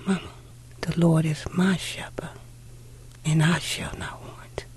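hushed, breathless whisper of the psalm; whispering so daddy stays asleep; whispered recital. Retold or imitated. Little girl waking her mother in the dark, whispering the psalm. Child whispering so father sleeps.

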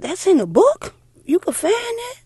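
put-on a kid who just learned it's in a book; amazed child. Little girl amazed her mother's psalm is written in the Bible.

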